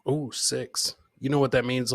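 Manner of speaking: ordinary delivery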